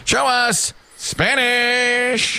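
high, drawn-out board call; game-show reveal call. Pretend. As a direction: stretch it out like a host's reveal. Host calls answer to board.

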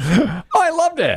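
high, drawn-out gush of delight; delighted exclamation. Real, not acted. Co-host insisting the accent was lovely, delighted exclamation. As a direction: gush slowly, pitch way up. Playful disagreement over the played accent.

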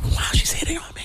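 high, excited whisper; whispered aside. Acted. Shopper thinking the saleswoman likes him, thrilled whisper. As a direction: whisper it like a thrilled secret thought. Acted secret thought, hushed.

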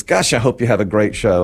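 ordinary talk, nothing marked

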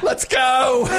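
high-pitched urgent holler; mock shout to rush everyone away; fleeing in panic. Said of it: "trying to flee a crime scene".

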